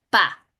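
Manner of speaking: pa sounded out as one blended syllable; pa as a demo blend. Shown as a demonstration. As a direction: join p and a into pa. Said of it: "cómo junto la p con la a".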